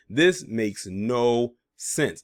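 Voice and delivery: very slow, falling and flat; deadpan repeat. Real, not acted. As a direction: drop it down slowly, done with it. Repeats line in disbelief.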